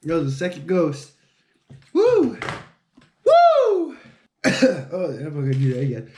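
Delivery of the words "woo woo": loud, much higher whoop from the burn; excited whoop. Real, not acted. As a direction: shout it out as the heat hits. Reacting to ghost pepper heat.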